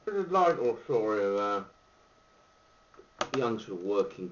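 talking around pipe stem; exhaling smoke while speaking; pipe in mouth. Real, not acted. Seen 3: Pipe held in mouth with smoke until about 4s, then lowered.

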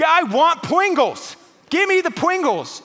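put-on very high, loud, slow toddler wail; tantrum toddler whine. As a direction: wail it like a three-year-old throwing a fit. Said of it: "She will throw fits".

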